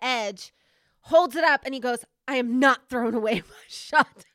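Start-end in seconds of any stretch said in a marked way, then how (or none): 2.3-4.2 loud declamation breaking into laughter; mock-heroic quote. Host quoting the movie's Hamilton line, laughing mock-heroic. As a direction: proclaim it boldly, laughing through it. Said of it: "does the stance of Hamilton".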